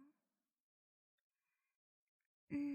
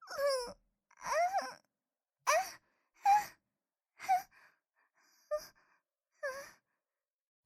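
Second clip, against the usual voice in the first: moaning; 11 semitones higher, 6 dB louder, much faster than usual. Acted. Climax moans.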